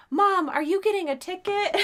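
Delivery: put-on high, loud little-kid voice; young child's question. Son to mom from the back seat, childlike alarm. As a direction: imitate a kid piping up from the back.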